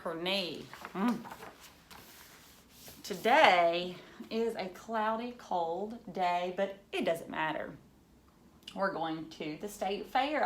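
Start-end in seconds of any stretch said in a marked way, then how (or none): 3.0-10.4 lively storybook reading for a class; reading to children. Acted. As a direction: read it like a picture book to kids. Teacher reads picture book aloud.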